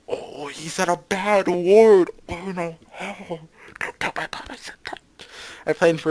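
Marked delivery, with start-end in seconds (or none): 0.1-3.4 slow, raised sing-out tattling; mock-scandalised. Player catching him saying a bad word, mock-scandalised. 3.8-5.0 hushed, voiceless plea; mock-secret whisper. Player hiding a bad word from mom, whispering.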